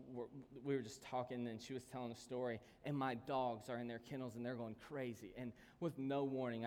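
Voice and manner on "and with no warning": ordinary talk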